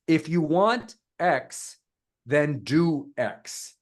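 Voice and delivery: very slow, weight on "want" and "do"; emphatic hook. Shown as a demonstration. Coach gives guys a template hook, slow and emphatic. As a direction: stretch "want", pause, then land "do X".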